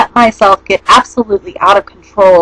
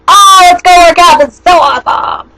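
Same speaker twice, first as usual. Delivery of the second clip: high, loud fake excitement; hammed-up gym lover cheer; mock enthusiasm; 8 semitones higher, 3 dB louder than usual. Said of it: "is like".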